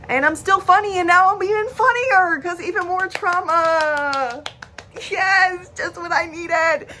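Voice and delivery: high, drawn-out, put-on jokey voice; loud, hammed-up comic gloating; mock cheerful sarcasm. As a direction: play up the sarcasm, high and stretched out. Joking sarcastically about more trauma.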